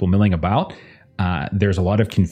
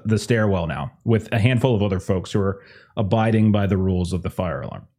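ordinary talk

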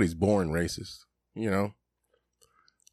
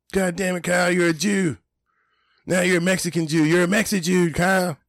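much higher, louder mock cartoon voice; Cartman impression; 10 semitones higher, 4 dB louder than usual. Retelling South Park episode, high shouty Cartman voice. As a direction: yell at Kyle like Cartman. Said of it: "And they had the Texas versus the Mexicans".

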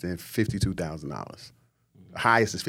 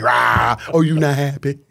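loud mock snap, a touch higher; mock angry wife; 4 semitones higher, 6 dB louder, slower than usual. Wife snapping at a husband tiptoeing on eggshells, loud mock anger. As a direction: bark it like a fed-up wife. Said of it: "And she like".

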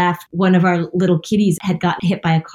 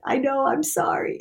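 quiet tearful apology; 6 semitones higher, 7 dB quieter than usual. Retold or imitated. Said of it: "So I said".

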